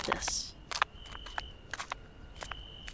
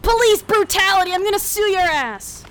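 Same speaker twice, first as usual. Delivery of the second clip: indignant shouting; 8 semitones higher than usual. Acted. Yelling after arrest.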